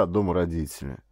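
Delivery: ordinary delivery, nothing marked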